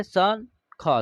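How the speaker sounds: शंख drawn out very slowly; very slow demo. Shown as a demonstration. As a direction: stretch शंख for pupils. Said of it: "स के ऊपर एक बिंदी है".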